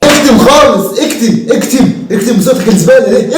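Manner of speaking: exasperated yelling; shut up shout. Acted. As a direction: cut him off with a shout. Shouting him down after singing.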